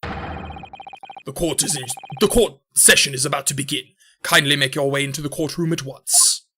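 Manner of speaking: put-on British court official; British accent. Official calling counsel into the courtroom, British accent. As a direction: announce it formally in a British accent. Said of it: "I almost forgot to do the British accent".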